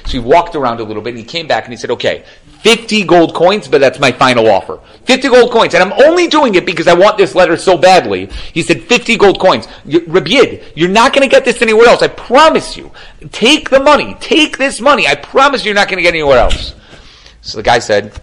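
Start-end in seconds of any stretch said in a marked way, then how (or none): 1.9-8.3 high, loud, grudging final offer; acted haggler raising the stakes; insistent haggling. Rich man makes final offer. 8.8-16.7 very high, emphatic pleading; acted haggler, loud and pitched way up; urgent persuasion. Rich man urges him to accept.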